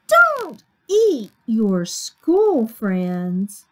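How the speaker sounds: put-on slow, emphatic children's complaint; drawn-out scolding. Children tell Sammy off for eating friends, scolding slowly. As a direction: spell out the telling-off word by word.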